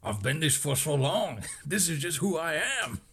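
lightly put-on French accent; imitated French accent. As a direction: quote him with a hint of French accent. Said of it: "he's talking in the French accent".

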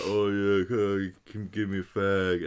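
lightly voiced drunk, low and drawn-out; a hint of a slow, smashed stranger; imitated drunk man. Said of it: "This guy was smashed out his nut".

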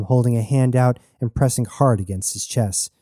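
ordinary delivery, nothing marked

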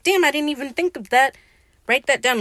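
high, loud mock astonishment; amazed realization. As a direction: imitate someone floored by a new idea. Retold amazed reaction of Steed.

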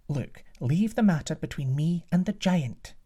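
calm queen taking charge; a hint of a regal queen; queen's voice. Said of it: "said the queen".

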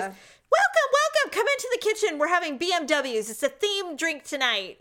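high, sing-song welcoming host; gushing hostess. Retold or imitated. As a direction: greet guests like a grand hostess. Imitating the mansion hostess.

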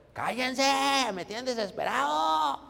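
irate leader. Retold or imitated. Preacher imitating an angry leader scolding the congregation, exasperated. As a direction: snap at the room like a hot-tempered boss. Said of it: "O iracundo, que se enoja de todo".

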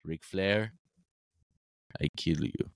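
low, flat awkward wrestling promo; deadpan threat in broken English; Giant Gonzalez promo. Retold or imitated. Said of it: "he just goes".

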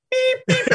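high mock truck beeping; reversing beep. GM imitating the RV backing up, high beeping.

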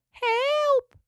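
tiny, very high creature voice calling for help; little cry. A narrator's reading. Trapped echidna cries from the bush, little high cry. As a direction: squeal it out like a small trapped animal. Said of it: "came a little cry".

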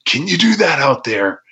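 mock excited ask, pitched high; imitating a thrilled booker. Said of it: "same thing as Paulie".

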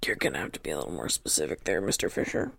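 in-character answer to the council, low and quick. Acted. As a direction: drop way down and rattle it off. Player voices character to council.

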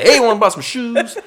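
high-pitched mock sales pitch; shoe salesman. Improv player reminding teachers of his character, shoe salesman. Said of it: "I was a shoe salesman".

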